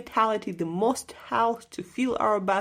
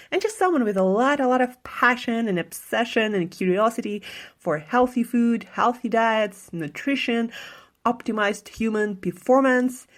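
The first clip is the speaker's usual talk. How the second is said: punchy, loud show-opener delivery; podcast intro read; 5 dB louder than usual. Acted. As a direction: announce the show with energy and emphasis.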